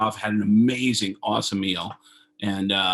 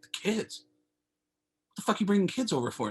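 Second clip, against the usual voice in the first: higher, fast, aghast question; shocked disbelief; 8 semitones higher, 3 dB quieter, faster than usual. Retold or imitated. Retold reply to Alicia about kids coming, shocked disbelief. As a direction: recoil at the news.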